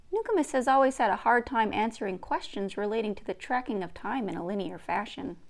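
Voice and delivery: usual speaking voice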